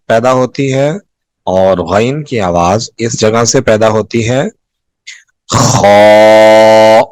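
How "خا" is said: خا with a strong rasping kh from the upper throat, said loud; throaty kha sound. Shown as a demonstration. Teacher demonstrating the throat letter to students, loud rasping kh. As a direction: scrape خا from the throat, loud and clear. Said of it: "یہ خا کی آواز".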